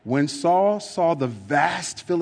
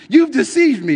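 mock scream; 11 semitones higher, 7 dB louder than usual. A narrator's reading. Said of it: "she screamed".

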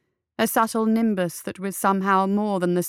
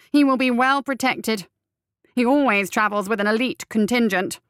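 higher, lightly chirping voice; chirpy Visser; 4 semitones higher, 4 dB louder than usual. A narrator's reading. Visser warns the conspirators, lightly chirping. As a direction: chirp the warning like a fussy little man. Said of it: "chirped Visser".

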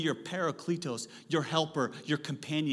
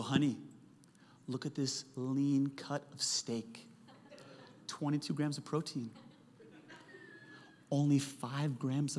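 seductive flirting; 2 semitones lower, 8 dB quieter than usual. Pretend. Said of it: "I flirt very differently now".

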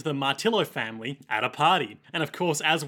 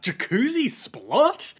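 much higher, slow baffled cry; all-caps outrage; 8 semitones higher, much slower than usual. Retold or imitated. Fan's baffled all-caps comment, high and slow. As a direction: read it like shouted caps. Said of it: "in all caps with an exclamation point".

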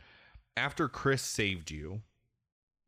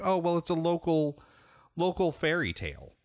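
a lightly higher, brighter wife voice, tossed off; casual higher wife voice explaining the local tale; lightly voiced wife; 10 semitones higher, 3 dB louder than usual. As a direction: breezily brush it off as a wife would. Wife quoted in game scene.

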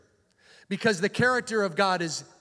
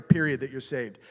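ordinary talk, nothing marked

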